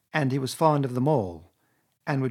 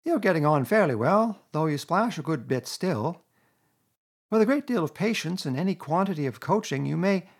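higher, mock-encouraging Rat; Rat's teasing voice; 6 semitones higher than usual. A narrator's reading. Rat ribbing Toad about his splashing, mock-kind. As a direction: gently needle him.

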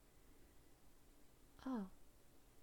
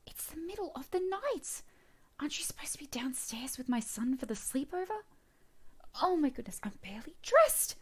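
higher and louder, caught off guard in alarm; flustered shock at a stranger in her bedroom; startled outrage; 8 semitones higher, 6 dB louder than usual. Acted. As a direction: gasp it out, shocked to find him there. Woman finds intruder in her room.

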